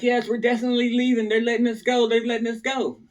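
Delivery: retold good news in a lifted voice; girlfriends announcing release. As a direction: imitate the girls sharing good news. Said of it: "They're like".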